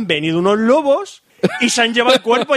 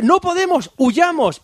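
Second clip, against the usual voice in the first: mock panic; 10 semitones higher, slower than usual. Soldiers flee the dinosaur.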